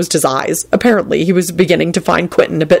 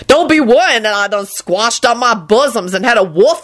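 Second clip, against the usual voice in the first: higher, aggrieved Southern-dialect woman; huffy dialect complaint; 5 semitones higher than usual. A narrator's reading. Woman airing her ordeal to the boy, huffy complaint. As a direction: grumble indignantly in a raised voice.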